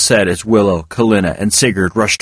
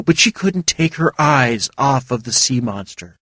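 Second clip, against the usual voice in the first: ordinary delivery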